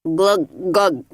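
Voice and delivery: gurgling glug sounds, like swallowing water; glugging while sinking. Acted. Mister Grinling sinking in the pool, glugging. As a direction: sink and gurgle. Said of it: "glugged Mister Grinling".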